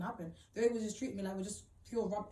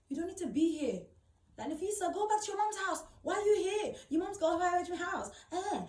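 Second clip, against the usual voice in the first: higher, louder imitation of his shouting; retold shouting; 7 semitones higher, 3 dB louder than usual. Imitating the boyfriend yelling at her to leave. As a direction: shout him down as he yells at her. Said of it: "when I see he's shouting".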